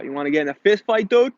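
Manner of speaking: fast mock New England challenge; New England accent. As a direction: toss out the question quickly like a New England local. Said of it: "end the sentences with guy or dude".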